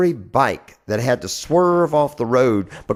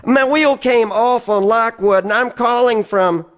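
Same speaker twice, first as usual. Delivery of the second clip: stranded friend on the phone; 10 semitones higher, 4 dB louder than usual. Acted. Friend calling for a tow.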